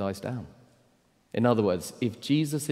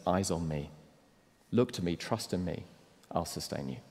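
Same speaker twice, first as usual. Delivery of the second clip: lower, soft, tender promise; hushed reassuring voice of Jesus; gentle reassurance; 3 semitones lower, 7 dB quieter than usual. Acted. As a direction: comfort them quietly. Quiet closing voice of Jesus.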